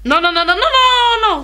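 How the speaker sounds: loud denial. Acted. Denies on the phone to husband.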